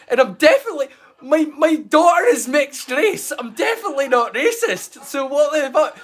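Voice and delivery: high, loud comic protest; hammed-up outraged self-defence; mock indignation. Mock protest against imagined accusations.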